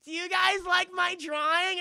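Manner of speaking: high, loud cutesy whine; hammed-up girly voice; mock Suki. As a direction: squeal it like a kid showing art.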